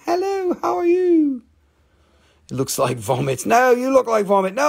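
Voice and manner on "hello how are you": high squeaky cartoon greeting; cartoon rock voice. Seller voicing a cartoon face in the rock, high and squeaky. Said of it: "It's saying".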